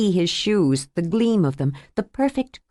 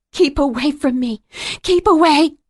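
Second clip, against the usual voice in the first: high, loud desperate yell; rising to a full panicked yell; frightened shout; 8 semitones higher, 4 dB louder than usual. Acted. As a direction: scream him away in panic. Woman fighting back, screaming.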